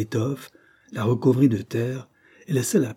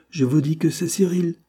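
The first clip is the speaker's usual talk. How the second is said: high-pitched, insistent assertion; 7 semitones higher, 3 dB louder than usual. A narrator's reading. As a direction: press the point, voice pitched up. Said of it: "persista la jeune fille". (insistance d'une voix haute)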